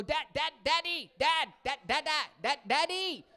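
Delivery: put-on high, insistent kid calling Dad; mock toddler pestering for attention; imitating toddler.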